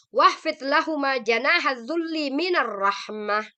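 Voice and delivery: teacher recites Arabic verse slowly and loud; Quran recitation. Shown as a demonstration.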